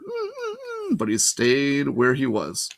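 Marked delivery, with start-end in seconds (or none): 0.0-1.0 high, soft dog whimper. A narrator's reading. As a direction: whine like a sad giant dog. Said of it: "Cerberus whimpered".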